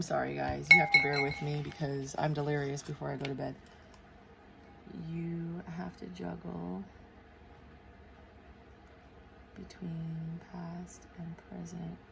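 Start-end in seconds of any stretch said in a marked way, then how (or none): 5.1-12.0 very soft, slow self-dictation; whispery, drawn-out note to self; murmuring while writing. Real, not acted. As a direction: sound it out slowly under your breath. Murmurs words while writing them down.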